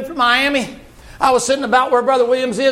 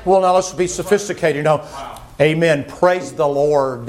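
pompous restraint, pitch dropped right down; sophisticated churchgoer; 9 semitones lower than usual. Acted. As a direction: parody stuffy, unexcited piety. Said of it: "I don't get excited".

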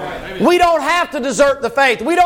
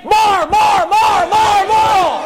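crowd calling more; 5 semitones higher, much slower than usual. Retold or imitated. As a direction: whip it up like an eager crowd. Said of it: "You ought to be saying".

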